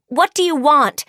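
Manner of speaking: shouting. Acted. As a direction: shout the demand across the room. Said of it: "Frankie shouts out".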